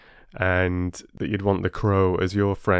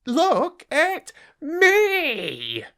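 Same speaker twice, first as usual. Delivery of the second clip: very slow, high rat voice stretching look at me; a preening rat milking every word; drawn-out boastful rat; 3 dB louder, much slower than usual. Acted. Rat shows off again.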